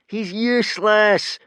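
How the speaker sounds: high, loud, slow gloat; gleeful crowing. A narrator's reading. Bully crowing over Hiccup's defeat, gleeful. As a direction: crow over his failure. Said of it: "crowed Snotlout gleefully".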